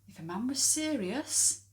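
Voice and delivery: ordinary talk, nothing marked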